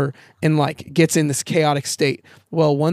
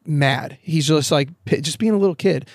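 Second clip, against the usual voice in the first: ordinary delivery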